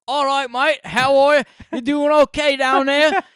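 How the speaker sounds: loud, high, hammed-up Aussie bellow; Australian accent. Greek comic mocking Australians in bed, Australian accent. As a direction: holler it like a caricature Australian. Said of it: "In Australia, it was, he'd be like".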